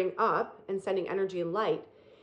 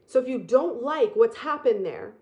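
ordinary delivery

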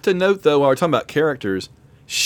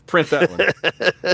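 director's verdict; 3 semitones higher, much slower than usual. Retold or imitated. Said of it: "they're like".

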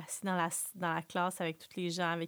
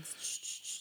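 soft imitated shushing; quiet mock shush; 9 dB quieter than usual. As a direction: hush someone with repeated tch sounds. Mimics man shushing the mother.

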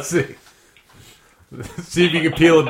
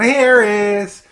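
loud high call of the name; yelling name; 8 semitones higher, 5 dB louder than usual. Acted. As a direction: shout the name out.

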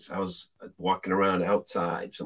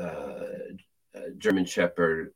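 ordinary delivery

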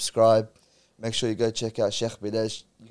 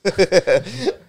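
loud burst of laughter; 9 semitones higher, 10 dB louder than usual. Real, not acted.

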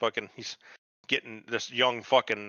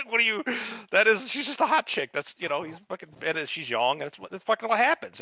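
much higher, exasperated ranting; worked-up rant; 9 semitones higher than usual. Real, not acted. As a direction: argue it like it's obvious. Heated rant about cheating.

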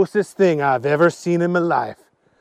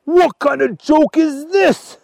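high, slow exclamation of disbelief; acted indignant outburst; outraged disbelief; 11 semitones higher, 4 dB louder, slower than usual. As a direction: balk loudly at a bad joke, drawn out. Archie's outraged reaction to the food.

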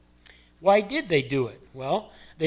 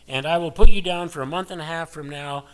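mock loud booking; 11 dB louder than usual. Mock Jesus giving appointment.

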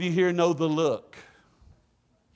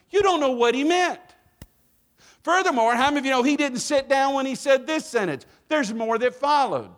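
high, loud climactic preaching; preaching at full force; 10 semitones higher, 4 dB louder than usual. Real, not acted. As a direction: build it up to a rousing peak. Building preaching.

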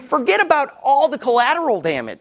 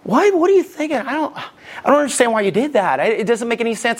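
exasperated questioning, pitch jumping up; blunt incredulity; 3 semitones higher than usual. Retold or imitated. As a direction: demand an explanation, baffled and impatient. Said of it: "I'll say it a certain way".

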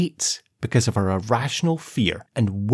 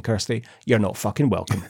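usual speaking voice

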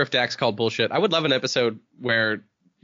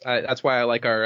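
normal talking voice

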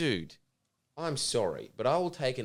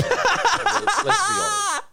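high squeaky voice; 8 dB louder, much slower than usual. Real, not acted. Joking aside to co-host.

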